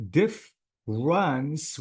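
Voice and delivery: "difference" drawn out as diff, runs; two syllables. Shown as a demonstration. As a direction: slowly separate the halves of "difference". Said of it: "we have two syllables".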